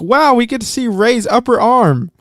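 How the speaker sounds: high, gushing fan quote; excited fan voice. Retold or imitated. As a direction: gush it like an online fan. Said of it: "someone online is like".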